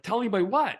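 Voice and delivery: surprised. Retold or imitated. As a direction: caught off guard by the news. Retelling his stunned trade reaction.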